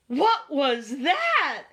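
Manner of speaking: high, loud, drawn-out alarm; frightened. A narrator's reading. Rabbit dropping his mug at a knock, frightened. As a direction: stretch the question in fright.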